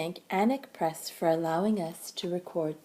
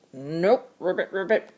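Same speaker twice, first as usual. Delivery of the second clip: croaking frog; 2 semitones lower than usual. A narrator's reading. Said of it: "croaked the frog".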